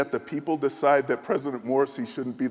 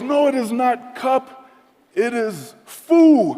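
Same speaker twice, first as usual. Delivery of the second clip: very high, loud, slow emphatic shout; shouting general; 12 semitones higher, 10 dB louder, slower than usual. Retold or imitated. General shouting the word at the envoy, high and slow. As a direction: bellow the correction, drawn out.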